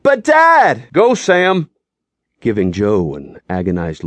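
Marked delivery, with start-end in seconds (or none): 0.0-0.9 put-on high, loud boy's cry; desperate boy. Younger son protests to his father, high and desperate. 0.9-1.7 a raised, firm command; stern order. Acted. Father sends his son away, stern.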